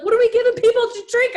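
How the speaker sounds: high, loud mock of her husband's question. Wife mimicking husband asking about drinks, high and loud. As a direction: squeak it out like a whiny husband. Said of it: "He's like".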